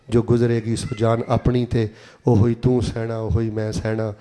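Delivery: low, even rhythmic verse; recited poem. Acted. (ਨੀਵੀਂ ਸੁਰ ਵਿੱਚ ਸ਼ਾਇਰੀ)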